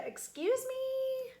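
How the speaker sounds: high, slow indignant excuse me; proud Rainbow Fish offended by the little fish; haughty excuse me. Acted. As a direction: act offended and superior. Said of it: "Rainbow Fish is like".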